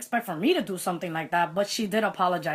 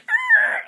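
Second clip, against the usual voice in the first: frustrated roar; 7 dB louder than usual. Retold or imitated. About to punch, stopped.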